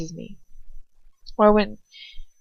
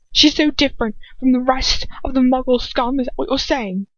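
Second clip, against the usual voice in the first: hard and mocking; 5 semitones higher, 4 dB louder than usual. A narrator's reading. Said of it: "his voice hard and mocking".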